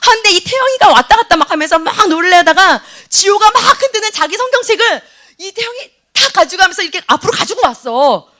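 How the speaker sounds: normal talking voice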